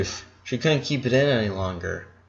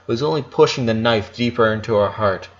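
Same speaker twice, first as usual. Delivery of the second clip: usual speaking voice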